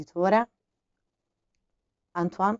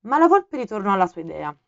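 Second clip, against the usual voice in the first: ordinary delivery, nothing marked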